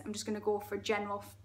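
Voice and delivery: plain reading voice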